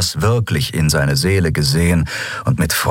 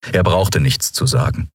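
plain reading voice

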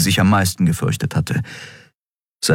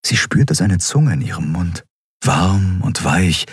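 ordinary delivery

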